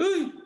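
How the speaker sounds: very high, loud startled yelp; a sharp cry of fright; piercing mock scream; shocked cry. Shock at own destiny.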